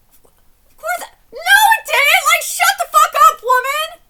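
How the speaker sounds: high, loud, cracking shout; screaming at the wife in the story; enraged scream. Real, not acted. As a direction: scream it at her.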